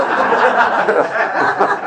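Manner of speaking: breathy unvoiced laugh; burst of laughter. Real, not acted. Preacher cracking up at the cop asking for prayers, laughing. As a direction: laugh out at the joke.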